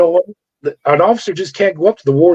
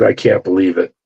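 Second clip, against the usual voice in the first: disappointed; 8 semitones lower than usual. Retold or imitated. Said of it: "with such disappointment".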